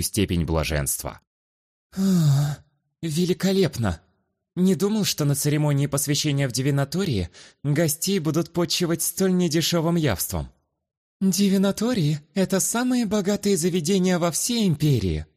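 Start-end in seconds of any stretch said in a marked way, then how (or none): 2.0-10.5 feigned delight. Acted. Protagonist voices delight at dinner. 11.2-15.2 high, poised lady's voice; haughty noble lady. Acted. Luana explains, character voice.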